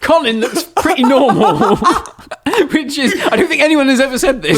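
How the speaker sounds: high-pitched, said through real laughter; laughing. Real, not acted. Podcast host joking to co-host about Colin's looks, laughing. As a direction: crack up while talking, pitch climbing. Cracking up at own joke.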